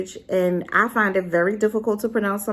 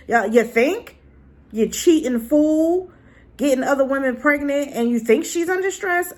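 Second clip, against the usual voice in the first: indignant rant; 6 semitones higher than usual. Real, not acted. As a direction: tell the cheater off. Ranting at the cheating husband.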